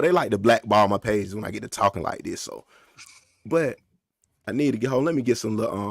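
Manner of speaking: normal talking voice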